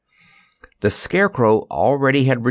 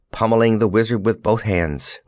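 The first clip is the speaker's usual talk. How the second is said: ordinary delivery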